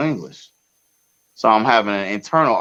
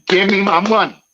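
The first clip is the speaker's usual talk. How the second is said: high, louder put-on demand; mock tough demand; 8 semitones higher, 3 dB louder than usual. Demanding his money back from the driver, hammed-up tough voice. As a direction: ham up the tough guy move.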